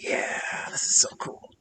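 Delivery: excited hushed whisper. Acted. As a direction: breathe it out secretly. Character creeps up, cutting hair.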